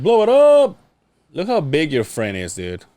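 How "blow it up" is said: louder call. Acted. Urging a friend to enlarge the photo, louder and higher.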